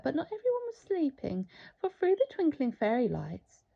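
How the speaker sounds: ordinary delivery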